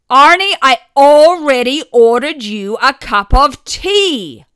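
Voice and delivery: loud, high, sharp bark. A narrator's reading. Wife barking at her half-deaf husband about his tea, loud and sharp. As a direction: snap it at your husband. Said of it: "my mother barked".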